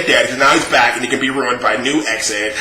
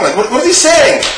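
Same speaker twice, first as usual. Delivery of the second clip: high exasperated repeat; 12 semitones higher than usual. Shown as a demonstration. Asking the viewers in high baffled disbelief.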